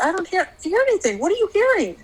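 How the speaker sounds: high, fast baffled questions; mother retelling her confused questions to her daughter, high and rushed; puzzled questioning. Retold or imitated. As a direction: ask like it makes no sense.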